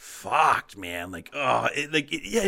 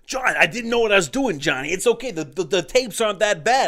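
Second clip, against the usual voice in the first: louder wiseguy pleading through laughs; teasing his friend as a movie gangster, hammed-up plea; mob guy voice; 8 semitones higher, 7 dB louder than usual. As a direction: ham up a movie mobster begging off a debt. Playing Ange to Johnny.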